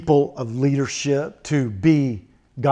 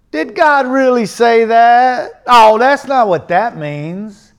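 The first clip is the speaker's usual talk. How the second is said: loud, high, slowly stretched mimicry; mock doubting; 6 semitones higher, 9 dB louder, slower than usual. Preacher imitating doubters of scripture, loud mock protest. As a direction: drag it out loudly in mock protest. Mocking doubters, repeating their question.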